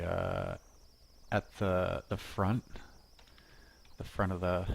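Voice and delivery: ordinary talk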